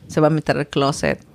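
normal talking voice

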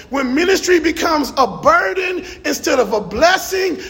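building pulpit shout; preaching hard. Real, not acted. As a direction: preach it at full force, slowing on the key lines. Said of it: "I feel like preaching right through here".

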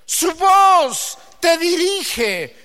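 high, loud, drawn-out preaching; preaching at full force. Real, not acted. Preacher proclaiming God's voice to the church, slow and loud. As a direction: proclaim it over the congregation.

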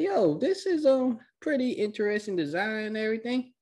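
high, put-on curious voice; mock admiring. Recapper as Sasuke looking around the dimension, mock admiring. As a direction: pitch it way up, lightly hammed-up wonder.

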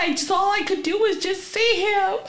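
high mock-tearful outpouring; imitated blubbering. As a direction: imitate a friend wailing out the story. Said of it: "she's blubbering and blubbering and blubbering".

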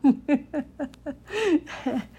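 a quick amused laugh, pitched high; giggling at herself about the cat; short laugh. Real, not acted. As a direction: laugh it off lightly. Laughing at cat's opinion.

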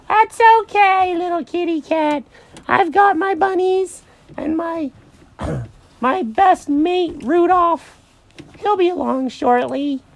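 hammed-up high rabbit voice; blithe Mary the rabbit. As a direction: brush the cat off in a stretched squeal.

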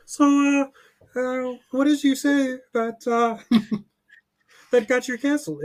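very high, halting interviewer; hesitant high impression of an interviewer; Jordan Peterson. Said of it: "we go on Jordan Peterson's podcast".

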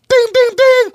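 high, loud ringing "ding" three times; imitated bell dings. Host answers his own top-of-the-list question, mock bell ringing. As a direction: sing out dings like a prize bell.

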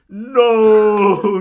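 very loud, high, long-held cry; shout. Acted. Dumbledore interrupts the revelation, huge drawn-out shout. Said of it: "he shouted".

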